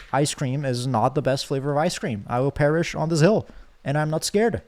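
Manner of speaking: ordinary delivery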